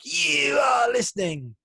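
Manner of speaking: high, loud and slow announcer yell; trademark intro shout. Shown as a demonstration. Host teases co-host with his booming trademark opener. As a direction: belt the opener like a ringside announcer. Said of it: "do you miss my trademark?".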